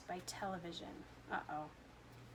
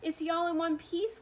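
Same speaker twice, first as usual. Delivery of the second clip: higher, loud worried cry; anxious cry; 7 semitones higher, 4 dB louder than usual. A narrator's reading. Mother anxious about her son, high cry. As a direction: cry out, fearing the worst. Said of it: "cried Missus T V".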